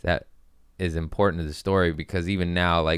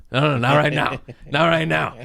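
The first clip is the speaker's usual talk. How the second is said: high, loud, urgent waving-off; acted embarrassed panic; panicked refusal; 8 semitones higher, 4 dB louder than usual. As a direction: beg a friend to back off now. Caught peeing, waves buddy off.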